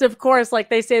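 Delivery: ordinary delivery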